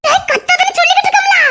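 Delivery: high, scolding shout; exasperated scolding. Acted. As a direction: snap at him to stop yelling. Bride scolds the yelling groom.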